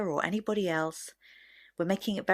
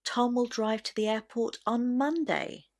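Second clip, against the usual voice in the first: MONDAY carries the final high fall; stress on MONDAY; 5 semitones higher than usual. Shown as a demonstration. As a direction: save the weight for Monday at the end. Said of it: "stress will naturally go on the word Monday".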